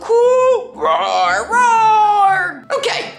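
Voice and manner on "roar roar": two playful roars, the second bigger; pretend T-Rex roar. Kid showing off the little T-Rex, pretend roar. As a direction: roar for a little toy dinosaur.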